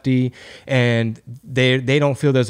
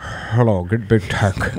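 lightly voiced decrepit foreign billionaire, a bit low; a hint of an old German tycoon greeting; mild old-man German voice; 5 semitones lower, slower than usual. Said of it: "really old, decrepit billionaire, like, foreign dude".

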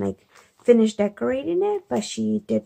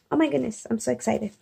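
normal talking voice

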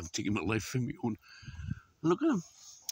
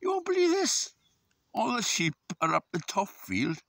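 excited; 10 semitones higher, 3 dB louder than usual. Real, not acted. Excited call to viewers.

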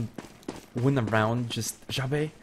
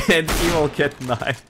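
bursting into laughter, louder; laughing; 4 semitones higher, 6 dB louder than usual. Real, not acted. Caster watching a knife kill, laughing. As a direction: call it through real laughter. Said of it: "I'm really sorry for laughing".

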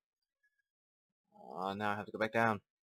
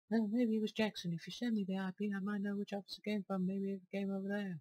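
higher, lightly voiced game character reading lines; 11 semitones higher than usual. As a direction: read the character's lines up high. Voicing game character dialogue.

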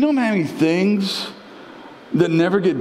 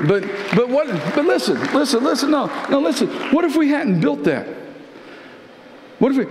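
excited urging; 8 semitones higher, faster than usual. Real, not acted. Preacher urging listen, rising.

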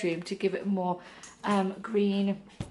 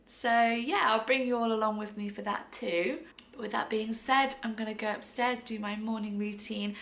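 ordinary talk

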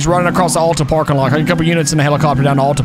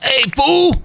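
imitating an aggressive shout of fool, pitched high; mock him yelling hey fool at you; aggressive call; 9 semitones higher than usual. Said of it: "Like, really aggressive towards me".

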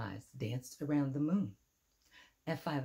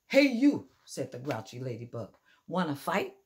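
loud grouchy challenge; 7 dB louder than usual. Acted. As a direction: yell hey at a lobster. Grouchy ladybug picks a fight.

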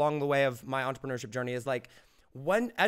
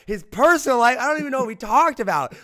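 higher and much louder, panicky disbelief; retold panic; 8 semitones higher, 10 dB louder than usual. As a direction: relive the moment you thought you blew it. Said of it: "Like, just freaking out".

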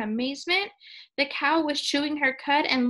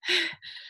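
acted puff for air; breathless panting; 4 semitones higher than usual. A narrator's reading. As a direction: pant as if just off a run. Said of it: "The first man said breathlessly".

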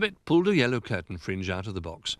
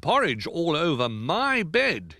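slow, loud, raised bear voice; indignant Old Bear; 8 semitones higher, 5 dB louder, slower than usual. Acted. Old Bear finds porridge on his bed, loud indignation. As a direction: demand it with slow emphasis.

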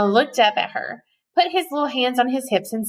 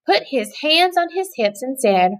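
plain reading voice

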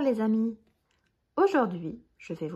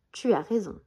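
ordinary delivery

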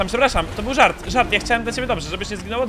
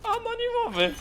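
mock surprise; 9 dB louder than usual. Real, not acted. Spots anonymous player.

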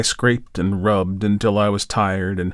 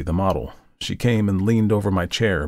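usual speaking voice

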